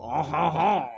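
mock-French chortle; hammed-up French "oh ho ho"; French accent. Shown as a demonstration. Host demonstrates starting a French voice, mock French chortle. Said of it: "to get your French voice going".

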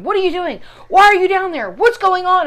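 worried husband. Retold or imitated. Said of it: "Steve keeps going".

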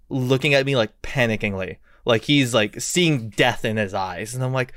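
ordinary talk, nothing marked

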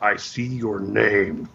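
slow, low creepy menace; creepy stalker voice. Pretend. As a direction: drawl it out like a stalker. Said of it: "Wow, creepy [name]".

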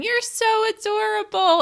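high-pitched gushing coo; giggling mom. Retold or imitated. Mom gushing over baby photos of her daughter, giggly high voice. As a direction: imitate mom cooing, voice way up. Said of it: "my mom is giggling, laughing, going".

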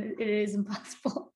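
usual speaking voice

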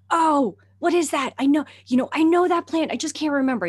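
puzzled excitement. Retold or imitated. Puzzling over a plant.

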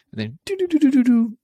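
a quick high do-do-do skitter; high running-off noise. Retold or imitated. The boy scampering off, a high do-do-do. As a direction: sing the scamper away up high. Kid runs off, scurry sound.

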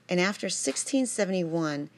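ordinary delivery

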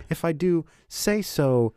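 slow, smug and self-satisfied; smug, drawn out. Shown as a demonstration. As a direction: stretch it out, pleased with yourself. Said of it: "put different pronunciation".

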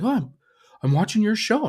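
put-on high kid voice, caught peeking; young son. Son explains himself to dad, high little-boy voice. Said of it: "And he's like".

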